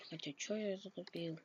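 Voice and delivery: normal talking voice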